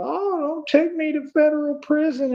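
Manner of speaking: high, whiny mock begging; mock crybaby plea. Mocking his enemies begging not to go to prison, whiny high plea. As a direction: mimic a sniveling crook pleading for mercy. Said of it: "be crying up a storm in a river and be like".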